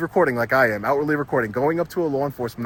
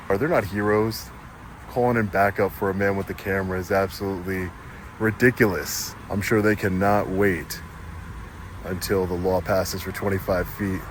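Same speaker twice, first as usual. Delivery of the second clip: voiceover narration; 10 semitones lower, 6 dB quieter than usual. Acted. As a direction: talk it over the footage, unhurried. Vlog voiceover commentary.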